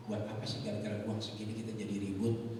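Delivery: usual speaking voice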